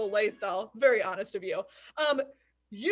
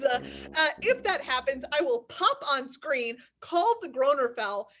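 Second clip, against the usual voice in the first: ordinary talk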